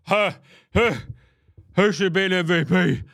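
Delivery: loud, put-on dopey pundit voice; mock Perkins. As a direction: play a self-satisfied pundit. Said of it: "He's like".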